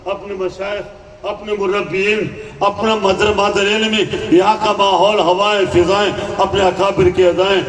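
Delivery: normal talking voice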